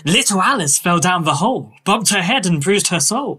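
airy, whimsical Victorian author; dreamy storybook eccentric, slightly lifted; Lewis Carroll. Acted. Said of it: "that, uh, performance".

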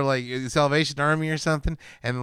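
ordinary delivery

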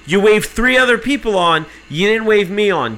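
loud, high indignant retort; retold shouting-match comeback; heated accusation. Arguing back at agent.

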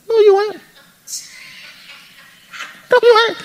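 squeaky high no you ain't; high mock retort. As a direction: pop it up high, teasing. Preacher mocks claim, high.

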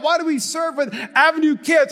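ordinary delivery

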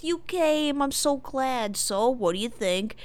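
delighted greeting, acted out; Steve. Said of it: "and he goes".

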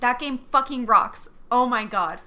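loud, slow, emphatic fan rave; excited raving. Real, not acted. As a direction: hammer out each word in loud enthusiasm. Bursts in defending a childhood favorite.

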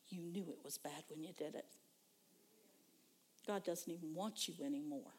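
soft, hushed accusing devil; sly devil whisper. Retold or imitated. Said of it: "devil's in there".

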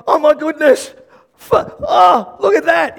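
high, loud, hammed-up alarm; acted panic. Servant sees soldiers from the window, acted panic. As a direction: cry out as if suddenly spotting danger. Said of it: "is the fear and the panic".

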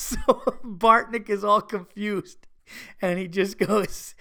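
slow words through real laughter; drawn out on a laugh. Real, not acted. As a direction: struggle to tell it while amused. Laughs at his friend's confusion.

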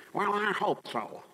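high, drawn-out mocking whine of doubt; mock doubtful hoping. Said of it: "make you wanna just go wacky doodle".

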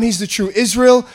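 normal talking voice

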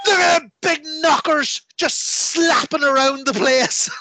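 high, loud, drawn-out mock commentary; leering commentator. Wrestling commentators ogling during a storyline moment, exaggerated leering shout. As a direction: impersonate a lecherous commentator yelling over the match. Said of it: "the W C W commentators would be going".

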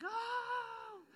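high excited "Oh!" of a crowd; a mock crowd gasping at a good diss. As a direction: hype it like a crowd at a rap battle. Crowd reacts to a rap diss.

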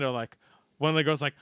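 ordinary delivery

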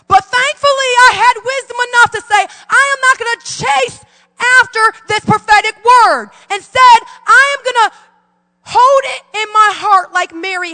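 high, loud fervent preaching. Acted. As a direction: declare it with rising fervor. Preaching at full force, higher.

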